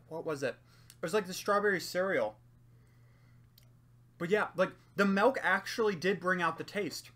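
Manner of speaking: speaking between bites; chewing while talking. Real, not acted. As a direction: mouth partly full of cookie. Seen 1: cookie held at mouth throughout, jaw moving between words.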